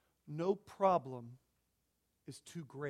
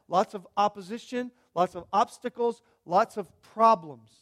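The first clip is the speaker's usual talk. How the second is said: preaching at full force; 2 semitones higher, 10 dB louder than usual. Acted. Preacher pressing the congregation about life's obstacles, loud and building. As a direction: build it like a pulpit climax.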